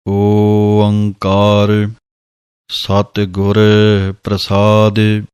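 drawn-out ਓੰਕਾਰ, then ਸਤਿਗੁਰ ਪ੍ਰਸਾਦਿ recited carefully; scripture recitation demo. Shown as a demonstration. Teacher models the opening line to students, chanting slowly. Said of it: "ਪਹਿਲਾਂ ਤਾਂ ਉਚਾਰਨਾ ਹੈ".

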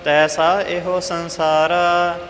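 ordinary talk

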